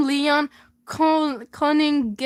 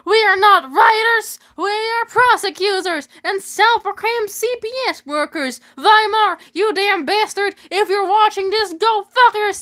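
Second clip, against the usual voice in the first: higher, louder, furious rant; angry woman ranting into a news mic, breaking into laughter; 6 semitones higher, 4 dB louder than usual. Acted. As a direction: scream at Weimar through the mic. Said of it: "An angry woman grabs the mic".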